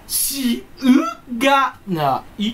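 "しうがない" very slow, each sound pushed hard; overstressed しうがない. Retold or imitated. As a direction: lean on every sound of "しうがない" proudly. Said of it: "強めに発音してる人". (一音ずつ強調した「しうがない」)

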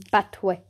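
battery said as "bat-twee"; jokey mispronunciation. Shown as a demonstration. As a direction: say battery as a silly two-syllable "bat-twee". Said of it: "saying that for the laughs".

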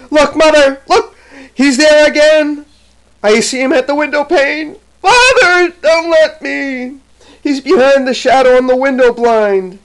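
put-on high, loud cry of a terrified child; wild frightened cry. A narrator's reading. Dying Jane cries to mother and father, wild frightened cry. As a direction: cry out in panic to your parents. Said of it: "started up and wildly cried".

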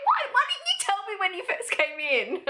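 high, giggling retelling; high giggly imitation of friend's surprise; laughing while quoting. Real, not acted. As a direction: laugh through the friend's shocked question.

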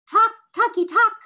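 very high, loud bird cry; hornbill call. A narrator's reading. Hornbill calls from a rock, very high and loud. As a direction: squawk it like a hornbill. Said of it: "a hornbill called".

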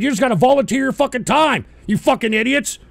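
ordinary talk, nothing marked

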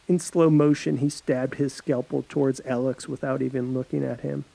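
usual speaking voice

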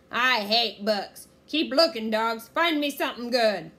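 loud bellow, slow at first; blustering pirate commands; angry pirate captain. A narrator's reading. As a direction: rage at the crew and order them on. Pirate captain berating crew.

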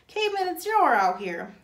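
high, loud call to another room; shouting. A narrator's reading. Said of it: "Squid shouted back into the rec room".

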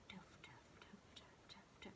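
soft unvoiced tuff beat sounds; whispered metronome beat. Shown as a demonstration. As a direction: whisper the metronome clicks steadily. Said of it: "So it's like".

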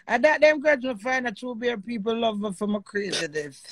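usual speaking voice

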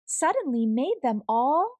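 ordinary delivery

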